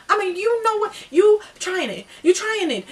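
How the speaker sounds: high, loud, indignant confrontation; confrontational outburst. Retold or imitated. Acted confrontation, hands in his face.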